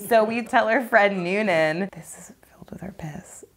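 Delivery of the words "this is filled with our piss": hushed, unvoiced secret; imitating a sneaky whisper; conspiratorial whisper. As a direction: whisper the prank to a friend. Secretly telling friend the plan.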